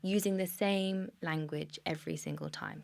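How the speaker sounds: ordinary talk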